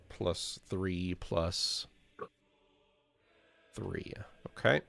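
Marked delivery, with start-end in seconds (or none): none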